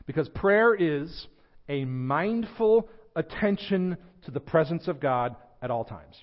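slow and weighty on "mindful"; "a mindful attention" drawn out word by word; slow deliberate definition. Acted. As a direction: spell it out slowly, stressing "mindful". Defining prayer slowly.

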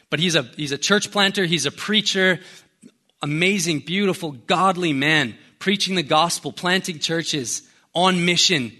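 normal talking voice

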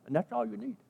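ordinary talk, nothing marked

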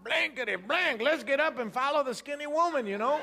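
high, laughing imitation of his buddy; excited friend. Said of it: "He said".

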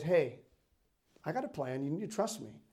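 low, soft answer as God; hushed, steady assurance; calm reassurance. Retold or imitated. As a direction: quietly settle an upset person. God calmly answers the upset prophet.

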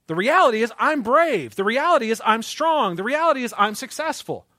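brash confident declarations; mock self-assurance. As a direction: puff up like an idealized self.

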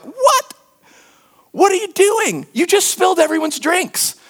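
high, squeaky disbelief; mock outrage at a friend spilling drinks; shocked outburst. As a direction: yelp it out in high-pitched shock.

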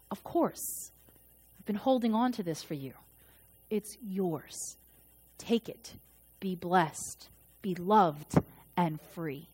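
God softly welcoming the returning believer; tender God. Pretend. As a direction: hand the gift over softly, lovingly. God gently offering grace.

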